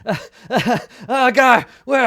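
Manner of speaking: high, loud hysterical cackling; freaking-out laughter. Acted. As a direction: laugh wildly, breaking into panicked cries. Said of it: "Krentz is laughing".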